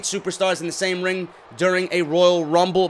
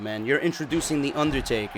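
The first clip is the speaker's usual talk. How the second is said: normal talking voice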